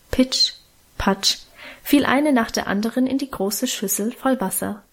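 ordinary delivery, nothing marked

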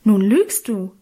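loud, indignant accusation. A narrator's reading. Sister accuses brother of lying, loudly indignant. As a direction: call it out in outraged protest. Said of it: "rief ich entrüstet".

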